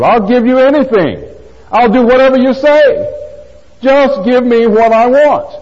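high-pitched, mock-desperate promises; hammed-up pleading, slowing on the final demand; overeager pleading. Mimics Peter's eager promises, demanding.

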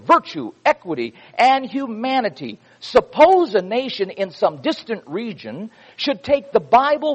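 fervent preacher's delivery; preaching a quote. Acted. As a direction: proclaim it to the congregation like scripture. Preaches long Adams quote.

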